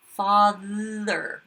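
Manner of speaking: "Father" with a voiced buzzing th. Shown as a demonstration. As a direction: let the th in "Father" buzz. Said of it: "I think it's a buzzer".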